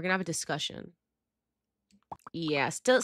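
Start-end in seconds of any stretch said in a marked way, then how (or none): none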